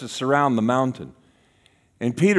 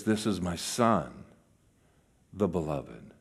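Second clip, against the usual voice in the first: low, hushed, measured pronouncement; voice from the cloud; 10 semitones lower, 12 dB quieter than usual. Acted. God speaking from the cloud about his son, hushed and low. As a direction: let it drop low and soft, with pauses. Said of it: "the voice comes from the cloud".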